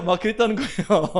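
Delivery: words dissolving into real laughter; laughing while telling. Real, not acted. Pastor reporting the sudden prayer request, laughing. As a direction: finish the line laughing.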